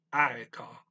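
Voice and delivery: "I call" pronounced loud and clear as a Finnish word; enunciated. Shown as a demonstration. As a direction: enunciate "I call" firmly, first syllable strongest. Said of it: "enunciate these, make 'em clear".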